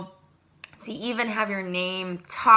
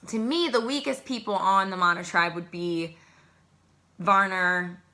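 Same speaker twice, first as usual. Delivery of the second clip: usual speaking voice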